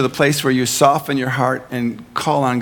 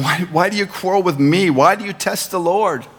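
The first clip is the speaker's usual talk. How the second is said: raised, pleading why; exasperated Moses; 6 semitones higher than usual. Acted. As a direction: Moses pushing back on the crowd. Moses answers quarreling people.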